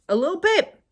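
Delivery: sing-song encouragement; gentle repeat. Shown as a demonstration. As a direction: coax it out step by step.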